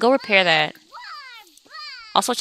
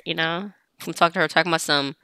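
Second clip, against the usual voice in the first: normal talking voice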